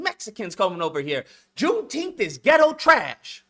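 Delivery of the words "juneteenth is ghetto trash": louder, slow and higher, each word hammered; slow loud declaration. Real, not acted. Ranting at the room, slow loud outburst. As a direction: spell it out loud and slow like a verdict.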